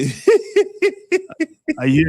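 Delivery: put-on joking Russian accent, slow and drawn out on the second line; co-host joins the Russian bit in a mock Russian accent.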